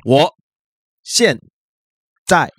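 each syllable spoken alone with pauses; choppy one-syllable-at-a-time Chinese; word by word. Shown as a demonstration. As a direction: chop the sentence into single syllables. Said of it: "你一个字一个字的讲".

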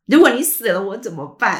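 son's panicked plea, half laughing as it's retold; emotional outburst. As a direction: blurt it out like a scared son. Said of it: "他可能很激动啊".

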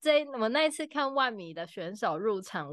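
normal talking voice

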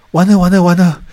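mock panic. Trader recalling doubts about own number sense, mock panic. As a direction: act out a sudden "I'm doomed" thought. Retelling own dismayed inner thought.

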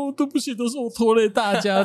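high, whiny mock-sobbing apology; imitating a crying recruit. Squad leader imitates the crying recruit apologizing to the squad. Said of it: "他就哭了，说".

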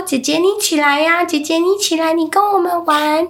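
put-on high little kid calling big sister; child's voice. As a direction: coax her to get up and play. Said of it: "那小朋友就说".

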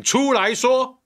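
slow, loud demand to come out and say it; a furious dare, drawn out; angry shout. Real, not acted. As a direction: bellow the challenge at the rival.

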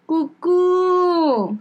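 put-on high, whimpering child's call; whiny little kid. Nephew sweet-talking his aunt after getting caught, mock whimper. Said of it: "他马上就这样".

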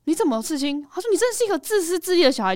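high-pitched, accusing parental scolding; retold reproach, pitched well up; guilt-tripping parent. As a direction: imitate a worked-up parent laying on guilt. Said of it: "那种父母亲情绪勒索式".

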